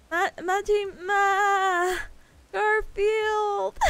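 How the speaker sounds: loud, high call with a long-held last syllable; exasperated name scream. Acted. Streamer imitates John screaming Garfield, drawn-out mock scream. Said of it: "like John screams Garfield".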